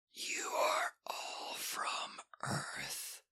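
slow rasping whisper of an ancient creature; ancient dusty rasp. Acted. Ancient creature warning the boy, slow rasping whisper. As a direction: breathe it out like dust from a tomb.

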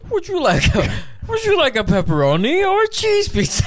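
high, loud squeaky monster voice; Cookie Monster voice. Pretend. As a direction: shout it like a squeaky puppet. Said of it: "Talk about pizza".

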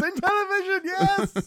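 high-pitched mock cheer; imitated excitement. Quoting co-host's script comment, excited mimicry. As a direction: squeal it like a thrilled fan. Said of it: "how excited he got".

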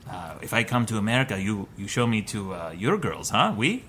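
put-on low, soft, half-hearted French accent; bad French accent. French guy asking to be shown American girls, bad French accent. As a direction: badly imitate a French guy, low and casual. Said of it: "I can't do a French accent".

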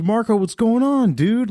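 high-pitched imitated hello to a buddy; friendly excited greeting. Friend spotting him at Subway, excited imitated greeting. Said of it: "Like that".

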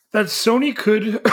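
choking mid-sentence; words caught by a cough. Real, not acted. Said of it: "coughing and choking on myself".

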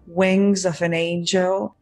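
ordinary talk, nothing marked

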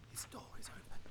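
hushed whisper at an open door. Acted. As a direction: breathe it, barely voiced. Creeping into stranger's house.

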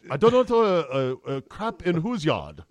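hesitant, lightly put-on Chinese accent. Chinese official answers Michigan's call, light Chinese accent. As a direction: answer the phone in a mild mock Chinese accent. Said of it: "I'll do a light Chinese accent".

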